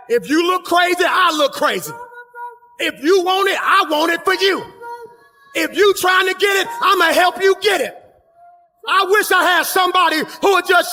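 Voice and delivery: high, loud, driving sermon cadence; preaching at full force. Acted. Preacher pumping up the congregation to shout together, full-force preaching. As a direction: whip the church up, every line a peak.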